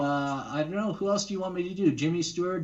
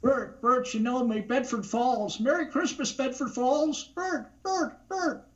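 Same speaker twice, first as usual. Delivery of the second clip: much higher, stammering pleading voice; Jimmy Stewart impression; 10 semitones higher than usual. Guest does Jimmy Stewart for a listener, high and stammering. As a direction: call out like George Bailey overjoyed. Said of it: "do Jimmy Stewart again".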